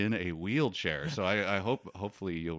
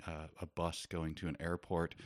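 normal talking voice

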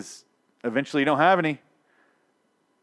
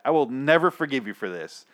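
ordinary delivery

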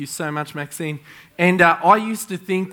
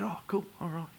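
breathless whisper, much softer; whispered aside; 2 semitones higher, 14 dB quieter than usual. Retold or imitated. Pastor hiding his surprise from Jessica, whispered. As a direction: hush it like a secret. Pretending calm after guessing name.

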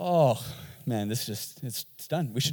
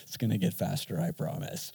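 mock bored listener; 8 semitones lower than usual. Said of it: "You're going".

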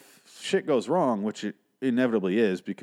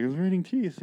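mock alarmed wife; 9 semitones higher than usual.

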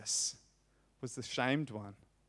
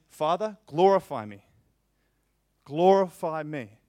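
louder, impassioned cry to the Father; fervent plea; 3 semitones higher, 9 dB louder than usual. Acted. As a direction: plead with force, "Glorify me" slowed. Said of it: "Jesus is saying".

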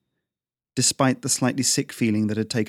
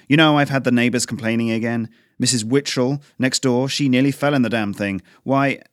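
scolding that builds louder and faster; building rant; 2 semitones higher, 5 dB louder than usual. Acted. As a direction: work yourself up as you scold. Father's mounting rant at son.

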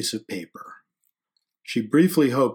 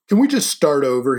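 loud, raised, earnest plea; begging; 4 semitones higher, 7 dB louder than usual. A narrator's reading. He asks the girl for a fresh start, begging. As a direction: plead for another chance. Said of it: "he begged".

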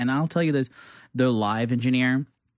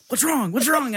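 much higher, mock-worried repeated question; imitating panicked friend; 11 semitones higher than usual. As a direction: play the friend freaking out on the phone. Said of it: "And you're like".

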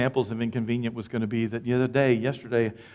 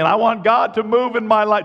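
higher, louder voiced plea; mock congregant plea; 9 semitones higher, 8 dB louder than usual. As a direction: play the eager listener. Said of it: "You're saying".

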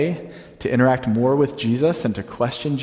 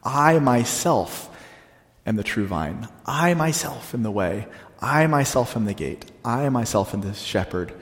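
"I myself" drawn out slowly, weight on "I"; stress on I myself; 3 dB quieter than usual. Shown as a demonstration. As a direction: lean on "I myself" each time. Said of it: "very emphatic personal pronoun".

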